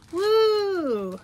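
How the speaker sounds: high, loud happy whoop; excited whoop. Real, not acted. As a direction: cheer it out with delight. Excited about the mystery bag.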